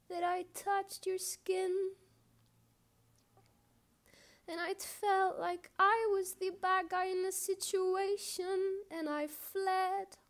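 pleading, high-pitched poetic apology; impassioned confession. Acted. Poem's confession and apology swells.

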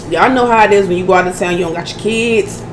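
ordinary talk

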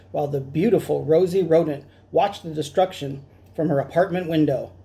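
ordinary delivery

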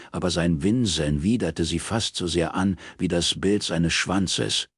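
plain reading voice

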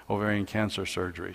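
ordinary talk

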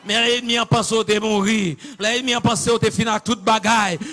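loud, high chanted sermon cadence; full-force preaching. Acted. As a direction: build phrase by phrase to a shouted climax. (prèch ak tout fòs, sou ton chante)

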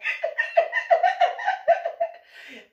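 high loud laugh; real laughter. Real, not acted. Laughing at the memory.

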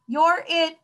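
high, loud taunt of tag; playground shout. A narrator's reading. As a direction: shout it high like an excited child. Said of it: "shouted a shrimp".

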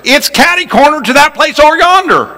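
a high, hammed-up Southern twang; Southern drawl. Shown as a demonstration. Preacher demonstrates a Southern phrase in a high drawl. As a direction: say it like a Louisiana local giving directions. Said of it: "here's some of the phrases".

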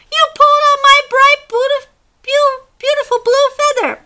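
very high, loud wail of loss; anguished squawk. Acted. Chicken Chuck to the monkey after losing his feather, high wail. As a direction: cry out over the lost feather. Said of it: "squawked Chicken Chuck".

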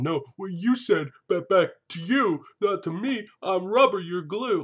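high, playground-style taunt; childish mock comeback. Host parodies the man's argument, childish high-pitched taunting. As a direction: bicker like a petulant kid.

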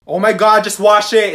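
usual speaking voice

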